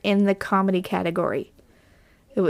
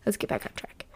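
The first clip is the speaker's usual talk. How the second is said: whisper; 12 dB quieter, faster than usual. Real, not acted. Whispered aside to self.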